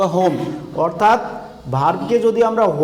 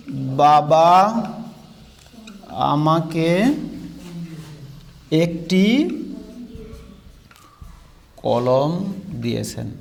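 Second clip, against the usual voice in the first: each word spoken very slowly with long gaps; slow dictation; 4 semitones lower, 4 dB quieter, much slower than usual. Shown as a demonstration. Teacher dictating a sentence for students to copy, very slow and spaced. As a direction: dictate it word by word so they can write. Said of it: "এবার লিখেন তো".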